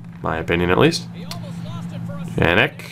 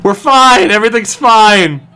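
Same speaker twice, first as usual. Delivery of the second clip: frantic reassurance; 7 semitones higher, 4 dB louder than usual. Real, not acted. Panicked reassurance during save.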